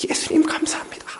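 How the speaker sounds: high whisper. Retold or imitated. The child repeats his thanks to Jesus in a high whisper.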